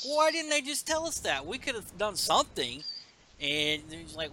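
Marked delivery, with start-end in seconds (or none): 0.1-2.8 high acted complaint; mock-indignant question, pitched up; exasperated character quote. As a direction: protest in a higher voice.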